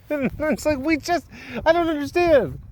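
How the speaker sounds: high, laughing exasperation; laughing disbelief. Real, not acted. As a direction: laugh it off in mock outrage.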